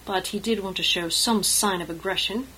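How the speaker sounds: plain reading voice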